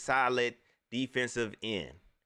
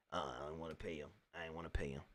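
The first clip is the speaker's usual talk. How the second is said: much lower, softer, quick grumble as the owner; mock stingy owner; 12 semitones lower, 15 dB quieter, faster than usual. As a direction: mumble it low like a cheap owner. Voicing the team owner.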